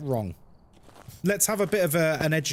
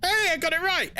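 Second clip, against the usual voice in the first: excited; 14 semitones higher than usual. Real, not acted. Pleased after nailing a word.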